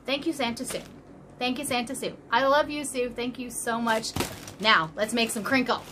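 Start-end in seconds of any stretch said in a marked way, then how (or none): none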